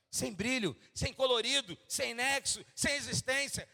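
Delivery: high, loud climbing preaching; fervent pulpit crescendo; preaching at full force. Acted. Climactic preaching and scripture.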